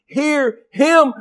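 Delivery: high, slow, proclaiming voice; voice of God. Acted. God proclaiming his beloved Son to the disciples. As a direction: announce it from heaven, high and slow. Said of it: "he said".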